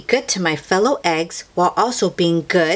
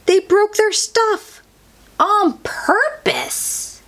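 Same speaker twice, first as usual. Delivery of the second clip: 1 mock outraged; slower than usual. A narrator's reading. Bad eggs misbehaving.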